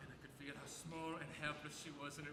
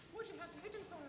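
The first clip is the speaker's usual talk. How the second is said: much higher, worried woman's voice; 13 semitones higher than usual. Retold or imitated. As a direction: pitch it up high as the anxious woman. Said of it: "I hear the woman going like".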